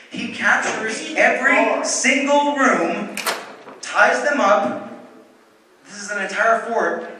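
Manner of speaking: loud, high, emphatic storytelling; triumphant climax. Real, not acted. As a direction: punch out the climax word by word, loudly.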